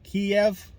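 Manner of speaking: Kiev said the Russian way, 'kee-ev'; Russian pronunciation. Shown as a demonstration. Vlogger showing listeners the name to avoid, Russian pronunciation of Kiev. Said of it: "Kiev is saying it in Russian".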